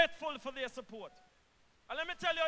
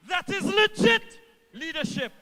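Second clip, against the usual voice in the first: louder rally emphasis; 4 semitones higher, 6 dB louder than usual. Real, not acted. Minister rallying supporters, loud emphatic repeat. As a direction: repeat it louder and higher, driving it home. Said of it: "repeats line for emphasis".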